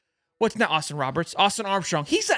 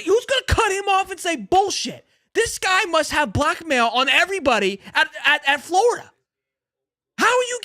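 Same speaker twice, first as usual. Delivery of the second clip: angry outburst; 7 semitones higher than usual. Real, not acted. Streamer rages about the coach getting away with it, shouting. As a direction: blow up at the coach. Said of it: "I'm pissed off".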